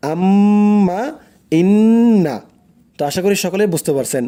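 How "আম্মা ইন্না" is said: আম্মা, ইন্না with the doubled m and n held and hummed through the nose; nasal ghunnah. Shown as a demonstration. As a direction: hum the doubled consonant long. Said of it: "তাহলে".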